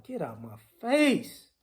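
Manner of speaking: normal talking voice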